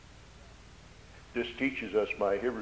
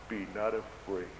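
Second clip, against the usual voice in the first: soft reassurance; 5 semitones lower, 12 dB quieter than usual. Acted. Jesus quoted speaking to disciples.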